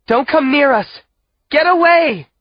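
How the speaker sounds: high, loud desperate shout; frantic warning. A narrator's reading. Mother warning her children away, frantic. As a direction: drive them away with your voice.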